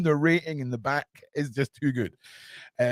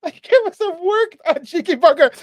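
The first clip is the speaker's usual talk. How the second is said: mock cheeky scolding; 7 dB louder than usual. Mock self-scolding after laughing.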